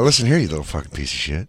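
low, fast gritted-teeth threat; through gritted teeth. Retold or imitated. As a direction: spit it through your teeth. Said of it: "through your teeth".

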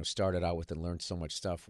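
ordinary delivery

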